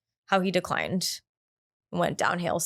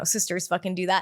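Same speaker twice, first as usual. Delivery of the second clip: normal talking voice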